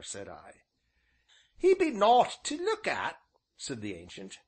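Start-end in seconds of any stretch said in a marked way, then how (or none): 1.6-3.2 put-on high old countryman's voice; old rustic innkeeper. A narrator's reading. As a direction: voice the old villager, folksy and drawn. Ancient's dialect voice.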